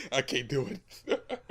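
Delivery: laughing through words; giggling, can't finish. Real, not acted. Breaks into laughter.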